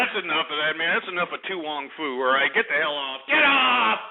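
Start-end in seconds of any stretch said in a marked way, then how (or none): 2.5-4.0 shouting him off. Real, not acted. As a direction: kick the caller off. Host ejecting caller.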